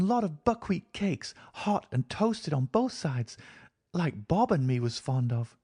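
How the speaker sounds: put-on high, childlike voice of a thirsty girl; a small girl speaking innocently; little girl. A narrator's reading. Girl asks her companion for water, childlike.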